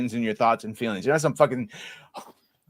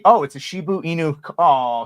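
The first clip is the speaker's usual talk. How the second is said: ordinary talk, nothing marked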